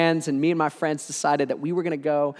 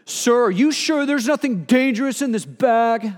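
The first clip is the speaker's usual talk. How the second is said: higher, louder stern officer ask; officer questioning; 7 semitones higher, 3 dB louder than usual. Retold or imitated. Guard holding the bag asks the speaker, stern. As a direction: voice the guard checking him.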